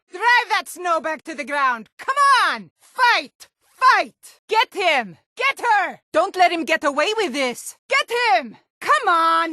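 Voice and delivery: chanting fight, fight; fight cheers. Acted. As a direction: urge the fighters on from the crowd. Crowd cheering a fistfight.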